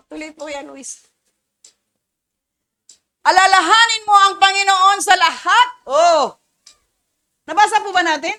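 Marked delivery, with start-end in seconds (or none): 3.2-5.7 scripture reading. Real, not acted. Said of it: "reading verse aloud".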